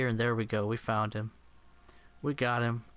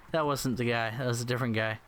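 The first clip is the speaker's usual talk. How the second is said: normal talking voice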